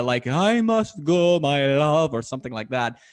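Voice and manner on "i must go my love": mock opera serenade. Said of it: "he starts singing opera to Maria".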